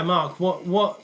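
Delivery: ordinary talk, nothing marked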